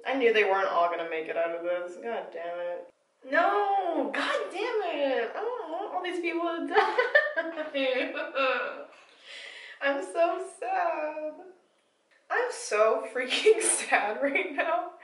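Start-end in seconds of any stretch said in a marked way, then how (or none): 2.0-2.9 muttered curse. Real, not acted. Reader groans at a death. 3.3-14.9 much higher, half-laughing, half-wailing protest; laughing in dismay. Real, not acted. Reader reacts to a beloved character dying, laughing in dismay.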